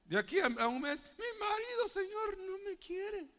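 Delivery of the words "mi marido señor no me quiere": very high, soft, whiny lament; mock plaintive wife. Wife complaining to the Lord about her husband, mock whining. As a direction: put on a high, pitiful wife's voice.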